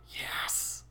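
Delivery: a soft, unvoiced yes; whispered cheer. Real, not acted. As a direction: whisper it in quiet triumph. Quiet cheer after the win.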